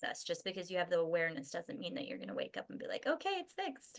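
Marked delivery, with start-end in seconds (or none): 3.1-4.0 high, chirpy mock relief; mock breezy. Waking up as if awareness fixed everything, mock breezy. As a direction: pretend it is all solved at once. Acted naive instant cure on waking.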